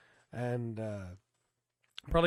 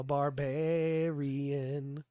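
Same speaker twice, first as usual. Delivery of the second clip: drawn-out name; much slower than usual. Pretend. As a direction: stretch out the Barbarian slowly.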